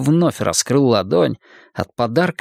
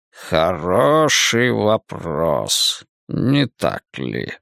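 sinister magician; 6 semitones lower, much slower than usual. Acted. Cain mocks choking boy.

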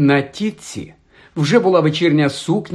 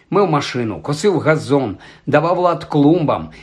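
plain reading voice

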